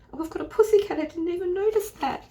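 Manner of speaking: high, loud burst of discovery; delighted surprise. Real, not acted. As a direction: pipe up as you suddenly spot something. Suddenly spots cat on the card.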